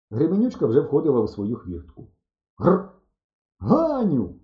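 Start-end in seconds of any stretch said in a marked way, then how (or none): 2.6-4.3 high-pitched call after someone. A narrator's reading. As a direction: call her name, voice lifted high. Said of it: "гукнув я". (гукаючи, високим голосом)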